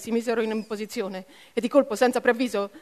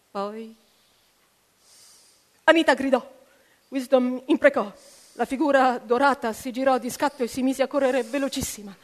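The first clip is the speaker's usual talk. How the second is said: ordinary delivery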